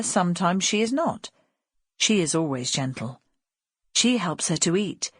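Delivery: plain reading voice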